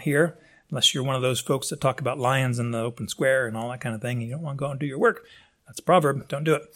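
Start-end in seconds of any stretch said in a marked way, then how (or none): none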